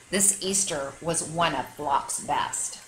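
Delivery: ordinary delivery, nothing marked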